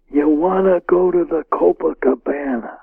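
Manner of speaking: a quiet, raspy invitation from a gangster; hoarse whisper. Retold or imitated. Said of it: "sort of a hoarse whisper".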